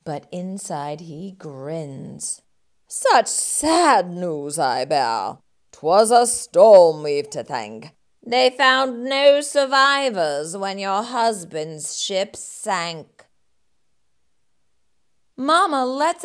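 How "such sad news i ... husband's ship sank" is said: scheming rat faking sympathy; fake sadness. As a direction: put on false grief. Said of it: "He pretends to be sad".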